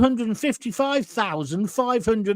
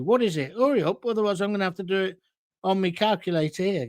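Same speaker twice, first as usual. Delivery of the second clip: usual speaking voice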